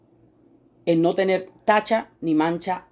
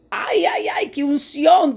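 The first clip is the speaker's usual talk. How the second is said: high ecstatic ay ay, slowing down; awed exclamation; 9 semitones higher, slower than usual. Retold or imitated. Believer in church, awed by the preacher's anointing, ecstatic high cry. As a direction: swept away by the anointing. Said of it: "que uno dice".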